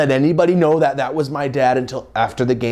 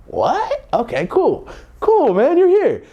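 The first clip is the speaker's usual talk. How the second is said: mock surprise; slower than usual. Son amazed his dad came to play catch, much higher squeak. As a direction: act stunned he showed up.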